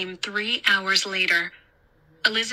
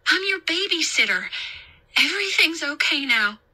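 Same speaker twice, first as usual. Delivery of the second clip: put-on higher voice, calling to a child; raised, soothing insistence; reassuring babysitter; 8 semitones higher than usual. A narrator's reading. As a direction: reassure a scared boy from across the room.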